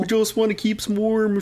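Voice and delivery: high-voiced Canadian thief excuse; badly faked Canadian accent; mock Canadian accent. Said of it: "is that a good Canadian one".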